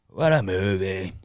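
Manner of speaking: drawn-out, lightly put-on impression voice; running impression. Comic showing off his bit, drawn-out mock impression. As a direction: stretch it out as a hammy bit. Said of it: "Mine's bad".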